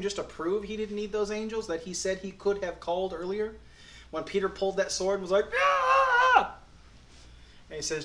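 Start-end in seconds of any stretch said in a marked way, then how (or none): 5.6-6.6 battle cry. Retold or imitated. Peter swinging the sword.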